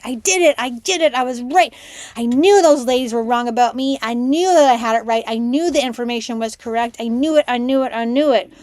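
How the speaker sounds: loud, insistent gloating cheer; reliving a victorious outburst; triumphant vindication. Retold or imitated. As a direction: crow it excitedly, hammering each repeat. Retold vindicated celebration.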